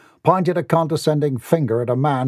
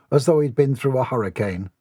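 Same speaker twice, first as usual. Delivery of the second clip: ordinary delivery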